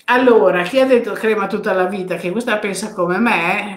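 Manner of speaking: normal talking voice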